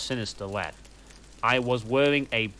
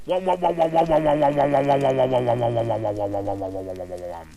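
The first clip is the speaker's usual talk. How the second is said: mocking wom wom chant; 5 dB louder, faster than usual. Narrator mocking the scene with a loud wom chant. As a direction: drone the wom sounds down to a fade.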